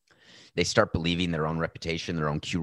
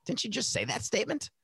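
mocking high voice; 13 semitones higher, 6 dB quieter than usual. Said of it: "And we'll say on Nothing Personal".

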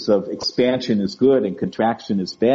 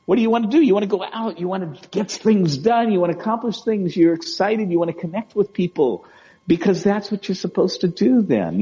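higher, lively and eager; excited, expanded; 7 semitones higher than usual. Acted. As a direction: sound lit up with energy. Describing feeling expanded.

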